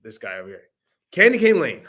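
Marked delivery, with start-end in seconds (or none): 1.1-1.8 louder, higher, laughing; laughing correction. Real, not acted. Fixing the title after his co-host's correction, laughing. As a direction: say it right through a laugh.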